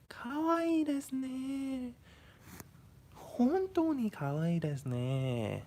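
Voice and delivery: high, drawn-out Japanese exclamations; hammed-up Japanese accent, swooping up high; Japanese impersonation. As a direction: gush in an exaggerated Japanese speaker's voice, stretching the endings. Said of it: "I shouldn't make Japanese impersonations".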